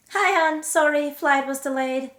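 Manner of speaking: loud, high, breezy husband; voiced husband greeting. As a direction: call out a cheery hello to the wife. Returning husband greets wife, voiced.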